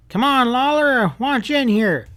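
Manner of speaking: high, loud pressing call; urgent plea. A narrator's reading. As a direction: demand he come now. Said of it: "he sounded urgent".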